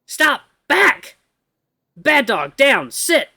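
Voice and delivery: high, loud dog commands; yelling dog commands at the husky; shouted commands. Acted. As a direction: yell at him like a dog.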